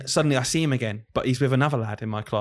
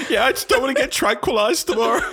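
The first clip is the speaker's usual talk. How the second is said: put-on much higher, louder whiny child; kid voice; 12 semitones higher, 5 dB louder than usual. Teacher voicing a child telling mum, high and whiny. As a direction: whine it like a sulky kid.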